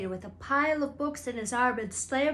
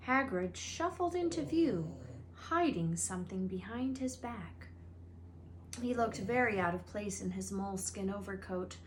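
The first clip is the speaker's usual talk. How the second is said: usual speaking voice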